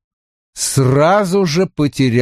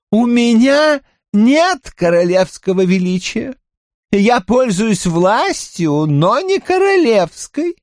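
countess voice; 11 semitones higher than usual. Acted. Mahaut continues.

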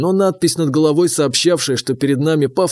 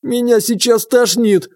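higher, slow moaning complaint; groaning; 7 semitones higher than usual. Acted. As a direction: moan it like you feel sick. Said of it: "простонал".

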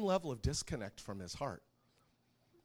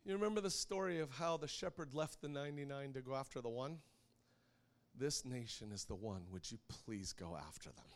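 put-on tender child's prayer; 8 dB quieter than usual. Daughter praying for the nation, hushed and tender. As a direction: sink gently into a quiet request to Jesus.

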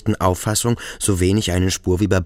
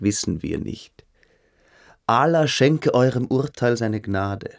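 Beineberg's languid voice; 2 semitones higher than usual. Acted. Beineberg grinning, smoking a pipe.

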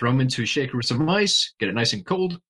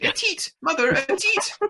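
much higher, piping street-urchin voice; child urchin voice; slower than usual. Pretend. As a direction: squeak it like a cheeky Victorian urchin. Urchins in mock Victorian scene.

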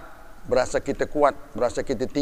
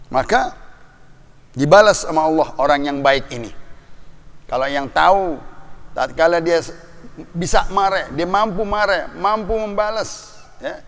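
loud and high, warning the proud; preaching at full force; 5 semitones higher, 4 dB louder than usual. Acted. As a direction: thunder a warning at the powerful. Preacher climax on holding anger. (khotbah berapi-api, keras dan tinggi)

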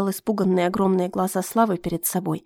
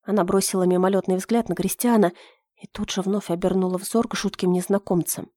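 ordinary delivery

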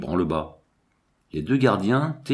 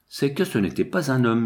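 plain reading voice

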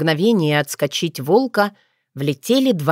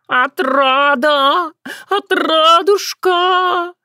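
long-drawn high howl, vowels stretched out; wailing yell; 11 semitones higher, 3 dB louder, much slower than usual. A narrator's reading. Cat hollering for the missing girl, wailing. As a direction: wail the name like a yowling cat. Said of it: "с завываниями орал Баюн".

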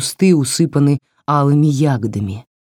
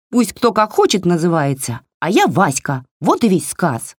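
higher, snappy little doll telling her off; scolding doll voice; 4 semitones higher than usual. Acted. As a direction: scold like a cheeky tiny doll. (высокий бранчливый голосок куклы)

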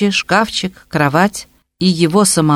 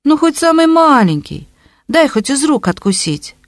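high whining plea; 9 semitones higher than usual. A narrator's reading. Fetchenko begs again.

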